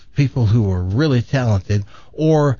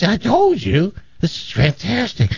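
higher, gleeful acted quote; delighted drunk; 5 semitones higher than usual. Drunk to his buddy after getting kicked out, mock glee. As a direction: play a drunk thrilled with his scheme.

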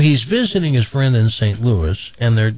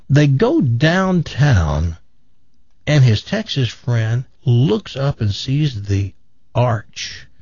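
usual speaking voice

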